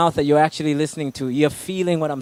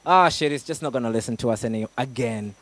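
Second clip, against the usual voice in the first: defeated inner critic; 8 semitones lower, 4 dB quieter than usual. Retold or imitated. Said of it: "that voice just goes like".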